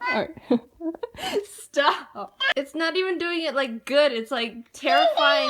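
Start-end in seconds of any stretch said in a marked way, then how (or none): 4.7-5.5 high pitched. Real, not acted. Reacting to creepy toy.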